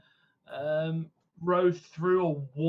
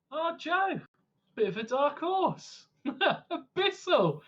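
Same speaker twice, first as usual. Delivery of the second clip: laughing; 11 semitones higher than usual. Real, not acted. Player laughs at Joe's secret.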